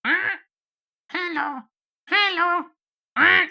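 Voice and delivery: high squawking parrot chatter. Acted. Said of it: "I need to find my parrot voice again".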